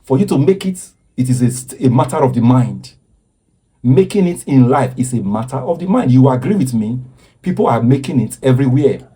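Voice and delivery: ordinary talk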